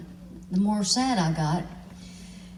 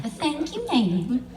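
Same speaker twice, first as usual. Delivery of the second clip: high, smug thank-you; cocky; 7 semitones higher, slower than usual. Retold or imitated. Pam accepting the old lady's praise, cocky. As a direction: preen like you own the stage. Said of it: "she gets all cocky".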